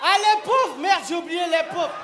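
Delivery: high, loud mock pity; loud call-out. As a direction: cry out to the forgotten ones. Tossing treats to the poor seats.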